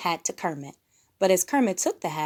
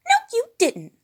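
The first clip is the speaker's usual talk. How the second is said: much higher, louder bratty voice; Piggy; 8 dB louder than usual. A narrator's reading. Piggy contradicting Fozzie over the hat, high and loud. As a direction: snap back like a spoiled diva. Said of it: "said Piggy".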